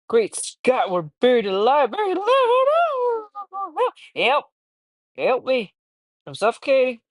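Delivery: high, loud panic climbing to shrieks; panicked cry. Acted. Reader voicing a trapped man's panic, high and loud. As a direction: shriek it out like you're buried alive.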